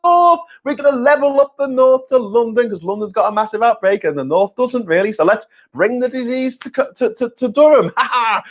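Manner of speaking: a high, giddy mock Cummings whooping his way north; mocking Cummings cheering as he drives covid up the motorway, high and giddy; Dominic Cummings. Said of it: "Dominic Cummings".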